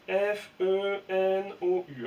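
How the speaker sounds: F E N O U épelé très lentement, lettre par lettre; épeler pour les élèves. Shown as a demonstration. (épeler F E N O U lentement)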